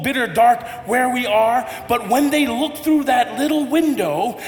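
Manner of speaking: ordinary delivery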